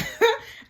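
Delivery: short high laugh, rising; high burst of laughter. Real, not acted. Laughs at "poking the snake.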